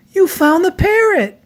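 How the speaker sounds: a gleeful cry of discovery; delighted surprise. A narrator's reading. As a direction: zookeeper finds George with the lost parrot, delighted high surprise. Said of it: "she said".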